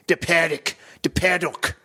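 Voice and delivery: exaggerated Kiwi-ish call-out; mock New Zealand accent. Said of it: "New Zealand or whatever he's supposed to be".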